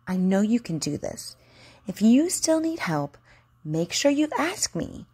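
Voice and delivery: ordinary delivery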